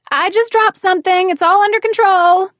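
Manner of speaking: high, loud, breezy cover-up; falsely cheerful. A narrator's reading. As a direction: call out like nothing happened. Heroine calling out cheerfully.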